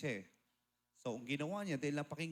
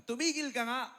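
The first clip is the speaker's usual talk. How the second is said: much higher, sharp yell; angry yell; 10 semitones higher, 5 dB louder than usual. Retold or imitated. Aunt shaking the preacher and yelling at him, much higher. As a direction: snap at him to stop.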